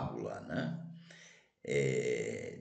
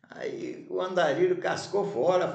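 ordinary talk, nothing marked

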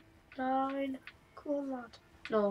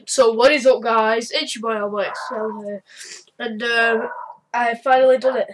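youtuber intro; 7 dB louder than usual. Acted.

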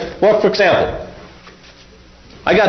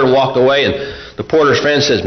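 usual speaking voice

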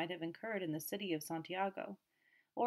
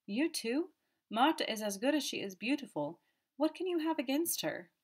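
high-pitched, pleading protest; crying out; 7 semitones higher, 4 dB louder than usual. A narrator's reading. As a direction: protest to mother in the girl's defence. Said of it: "cried the boy".

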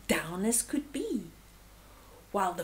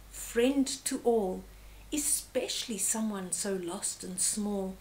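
ordinary delivery, nothing marked

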